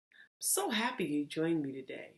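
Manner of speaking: usual speaking voice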